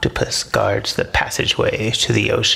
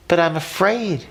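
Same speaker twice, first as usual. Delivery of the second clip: brave but afraid; 8 semitones higher than usual. A narrator's reading. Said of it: "Majib bravely states".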